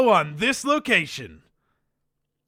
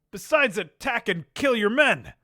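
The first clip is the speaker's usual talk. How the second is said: usual speaking voice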